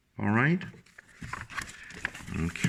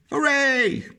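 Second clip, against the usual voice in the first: cheer. Shown as a demonstration. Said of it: "Can you shout hooray?".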